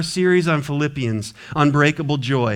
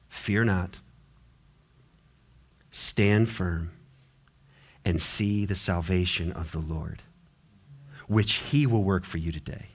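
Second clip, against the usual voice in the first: calm reassuring Moses; 7 semitones lower, 5 dB quieter than usual. Acted. Moses reassuring people, hushed.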